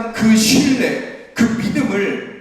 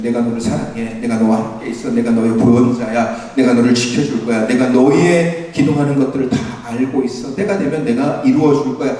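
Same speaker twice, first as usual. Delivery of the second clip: lower, gentle voice of God; still small voice; 7 semitones lower than usual. Acted. Preacher voicing God speaking to believers, gentle and low. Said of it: "아주 세미한 음성으로 작은 목소리로".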